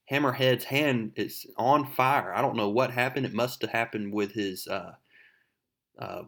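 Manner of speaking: usual speaking voice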